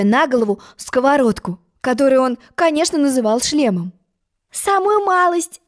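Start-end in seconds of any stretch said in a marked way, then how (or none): 4.5-5.6 high, slow gentle reassurance; a slow soothing little voice; reassuring. A narrator's reading. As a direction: soothe him kindly. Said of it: "успокоила его Алиса".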